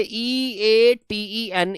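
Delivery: E A T E N drawn out slowly; dictating the spelling slowly. Shown as a demonstration. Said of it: "spelling verb form".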